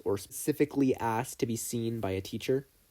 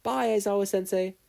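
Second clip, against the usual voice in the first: bright, much higher cheerful farewell; upbeat goodbye; 10 semitones higher than usual. Acted. Midoriya leaving his proud teacher, upbeat and higher. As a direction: bounce out the door with it. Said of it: "with an upbeat".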